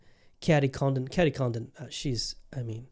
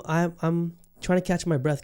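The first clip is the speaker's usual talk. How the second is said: usual speaking voice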